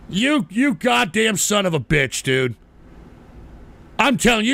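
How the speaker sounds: usual speaking voice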